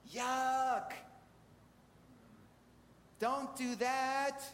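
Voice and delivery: drawn-out, high-pitched cry of disgust; mock yelling. Preacher voicing the world's protest to the congregation, mock yelling. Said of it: "The world around us is yelling".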